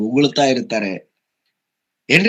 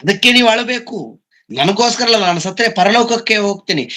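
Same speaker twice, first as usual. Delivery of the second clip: high, urgent voicing of Jesus' words; impassioned preaching; 9 semitones higher than usual. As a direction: proclaim it from the pulpit. Preacher voices Jesus fervently. (ಉತ್ಕಟ ಪ್ರವಚನ ಧ್ವನಿ)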